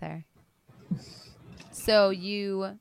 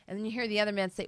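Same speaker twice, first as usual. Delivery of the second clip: normal talking voice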